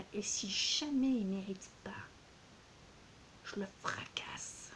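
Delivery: a playful whispered threat against the book; conspiratorial joke whisper; mock threatening whisper. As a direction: lean in and whisper the threat, half joking.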